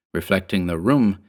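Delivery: plain reading voice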